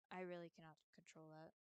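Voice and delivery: usual speaking voice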